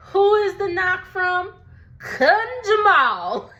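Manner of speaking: dramatic reveal. Acted. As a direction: draw out the name like a game show host.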